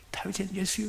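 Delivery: softly mumbled call; a small cry lost in the crowd; too faint to hear. Acted. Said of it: "이리가 안 들리는 거예요". (안 들릴 만큼 작은 소리로)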